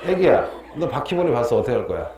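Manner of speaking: usual speaking voice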